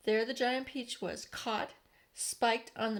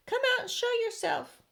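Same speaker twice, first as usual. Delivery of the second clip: high, loud hail upward; police shouting; 13 semitones higher, 6 dB louder than usual. A narrator's reading. Police calling up to the travelers, loud and high. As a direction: hail them from far below. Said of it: "shouted the police".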